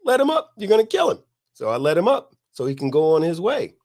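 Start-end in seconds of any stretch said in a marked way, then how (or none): 0.0-1.2 high, louder urgent plea; bystander pleading. Retold or imitated. Bystander shouting at the speaker to stop, high urgent plea. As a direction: call out to stop the fight. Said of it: "Someone says".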